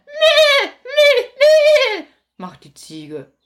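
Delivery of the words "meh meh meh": very high, loud bleating; loud goat bleat. Acted. Goat bleating loudly at Großmutter.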